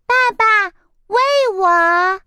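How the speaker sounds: put-on high childish wheedle; spoiled egg girl begging to be fed; whiny little chick. A narrator's reading. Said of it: "撒着娇说道".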